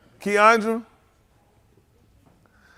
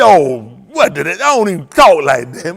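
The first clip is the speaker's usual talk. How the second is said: lower, louder mock of a deacon who cut his tongue; hammed-up deacon voice, pitched down and pushed louder; 5 semitones lower, 7 dB louder than usual. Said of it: "that deacon went to cut his tongue".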